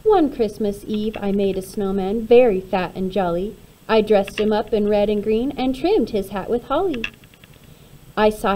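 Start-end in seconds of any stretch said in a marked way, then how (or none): none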